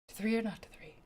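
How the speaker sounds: breathy unvoiced whisper. Real, not acted. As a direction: whisper it to yourself. Playful aside to chat.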